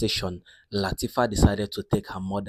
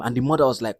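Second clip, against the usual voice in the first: plain reading voice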